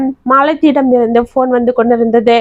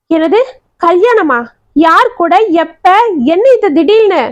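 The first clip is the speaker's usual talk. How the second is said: stunned questions tumbling out, much higher; teacher hears her student will marry suddenly; shocked; 6 semitones higher than usual. A narrator's reading. Rising disbelief, pitch climbing.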